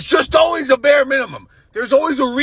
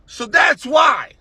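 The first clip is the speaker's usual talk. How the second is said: emphatic shout; 2 semitones higher, 3 dB louder, much slower than usual. Real, not acted. Ranting at a woman.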